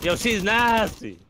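loud, high, slow praise; hyped. Real, not acted. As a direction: cheer her on. Teammate clutches, hype.

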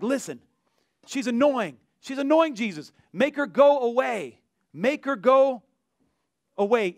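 mock annoyed complaint. Disciples pressing Jesus to send the woman away, mock annoyed complaint. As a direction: play at being fed up and insistent.

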